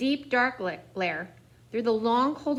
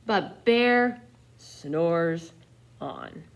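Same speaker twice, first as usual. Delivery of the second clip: slow lower sing-song refrain; drawn-out refrain; 5 semitones lower, much slower than usual. A narrator's reading. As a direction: stretch it very slowly, lower. Repeated sleepy refrain.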